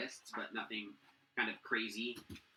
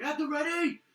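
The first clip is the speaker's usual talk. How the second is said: high, loud call to arms; shouted battle command; soldier's shout; 7 dB louder than usual. Acted. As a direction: bark the order down the wall. Soldiers on the wall called out.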